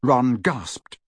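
ordinary delivery

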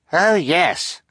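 put-on boy straining for a high voice; high falsetto. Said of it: "in as high a voice as he could manage".

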